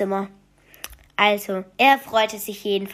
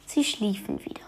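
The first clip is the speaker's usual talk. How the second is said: hushed spooky storyteller whisper; 7 dB quieter than usual. A narrator's reading. Narrator telling a bedtime story in a spooky whisper. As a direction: breathe it like a secret in the dark.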